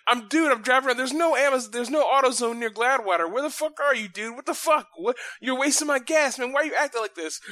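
high, loud, fed-up rant; exasperated yelling. Retold or imitated. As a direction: blow up at a stranger wasting your gas. Retelling an angry confused texter.

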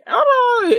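high, loud babbling din; hammed-up yelling and barking noises; imitated household racket. Mimicking the noise in his friend's house, shrill mock yelling. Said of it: "is kids yelling, dogs barking, women screaming".

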